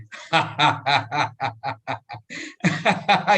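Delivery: hearty demo laugh; laughter yoga demo laugh; appreciation laugh. Shown as a demonstration. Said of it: "you argue with laughter".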